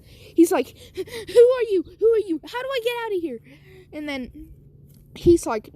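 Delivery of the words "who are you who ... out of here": put-on high-pitched, panicked little boy; scared. Startled boy to a strange robot, acted high-pitched fright. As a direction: blurt it out high in mock terror. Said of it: "scared for his life".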